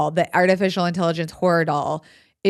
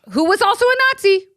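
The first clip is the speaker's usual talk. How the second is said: excited punchline; 13 semitones higher, 5 dB louder, faster than usual. Real, not acted. Punchline fun fact.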